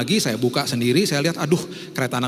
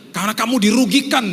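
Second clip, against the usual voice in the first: higher, louder insistence; insistent urging; 9 semitones higher, 7 dB louder than usual. Retold or imitated. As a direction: hammer the point home. Friend urging a fake claim. (desakan keras dan tinggi)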